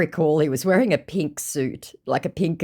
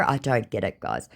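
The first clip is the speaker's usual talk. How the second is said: normal talking voice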